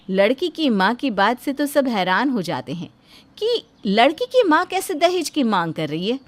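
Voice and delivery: ordinary delivery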